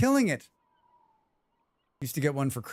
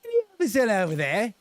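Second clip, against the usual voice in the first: higher, louder mock Cockney accent; 8 semitones higher, 3 dB louder than usual. Streamer mimics the game's Cockney character, mock Cockney accent. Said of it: "Why is he speaking with a Cockney accent?".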